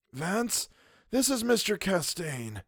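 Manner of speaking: put-on high, slow, hushed old man's voice; a wounded old man, weak and bitter; Mister Wilde. A narrator's reading. Wilde complaining to the narrator, high and hushed. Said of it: "said Mister Wilde".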